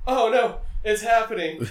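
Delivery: high, loud mock-panicked Thor; hammed-up alarm, voice jumps up. Retelling the movie, voicing Thor in mock panic.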